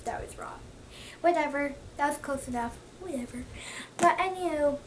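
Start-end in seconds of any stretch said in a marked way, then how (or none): 0.0-0.6 soft unvoiced whisper; whispered aside. Real, not acted. Admitting to herself she misquoted, whispered. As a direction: mutter it to yourself in a whisper.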